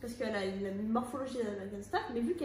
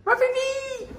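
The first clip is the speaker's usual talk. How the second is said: imitation aiguë et forte de « ma fille »; voix aiguë moqueuse; 12 semitones higher, 10 dB louder, much slower than usual. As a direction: reprend « ma fille » d'une voix de tête. Imite « ma fille » en aigu. (voix haut perchée qui singe)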